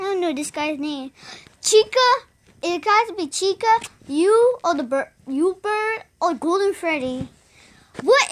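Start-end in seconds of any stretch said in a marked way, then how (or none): none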